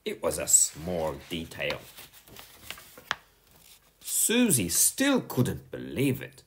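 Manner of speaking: ordinary delivery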